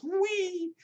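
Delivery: put-on a very high, childlike squeal of delight; mock glee. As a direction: squeal like a kid being lifted up. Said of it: "And William just goes".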